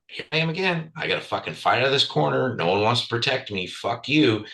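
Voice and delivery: high-pitched, fed-up inner protest; retold frustrated rant. Backed into a corner at dinner, indignant inner rant.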